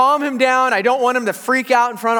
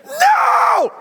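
piercing high, drawn-out refusal; imitated toddler scream; 13 semitones higher, 3 dB louder than usual. Two-year-old screams at his dad, high and defiant. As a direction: shriek it like a tantruming toddler. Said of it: "He just screams".

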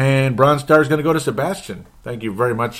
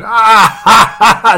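loud, high "ah ha ha ha"; mock guffaw; 12 semitones higher, 11 dB louder, slower than usual. Podcaster imitating Viking fans watching rivals lose, mock guffaw. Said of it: "and they're going".

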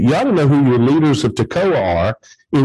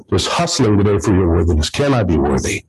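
usual speaking voice

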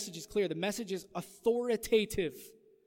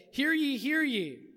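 town crier; 6 semitones higher, 5 dB louder, slower than usual. Acted. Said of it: "I can imagine the".

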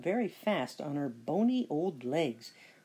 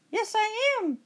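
high squeaky cat voice; the cat pretending to be Sasha; 3 dB louder than usual. A narrator's reading. Cat answering the witch for Sasha, high voice. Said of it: "said the cat".

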